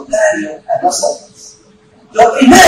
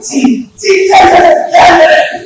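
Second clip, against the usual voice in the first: eager yes; 7 semitones higher, 4 dB louder, slower than usual. Retold or imitated. Colleagues ask if he's arrived.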